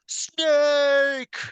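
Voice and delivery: high, loud, drawn-out call; imitated yell. Villain actor yelling back at the hero actor, imitated long yell. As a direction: stretch the name into a high holler. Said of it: "look back at him and just yell".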